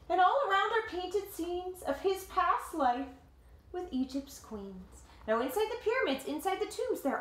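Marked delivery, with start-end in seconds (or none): 0.1-5.0 lively read-aloud voice, higher than the chat; story narration. A narrator's reading. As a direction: tell it like a picture book to kids. Reading the picture book aloud.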